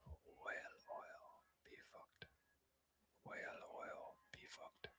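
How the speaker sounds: barely audible slow whisper; whispering to self. Shown as a demonstration. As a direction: try the phrase out under your breath. Tries phrase out under his breath.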